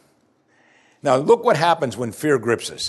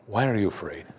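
low, soft, falling question; quiet questioning; 4 semitones lower, 6 dB quieter than usual. Acted. Jesus to frightened disciples after the storm, quietly probing. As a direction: ask it gently, without force.

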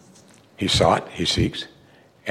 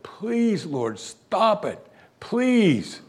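pleading; 7 semitones higher, much slower than usual. Retold or imitated. Paul begging the Lord.

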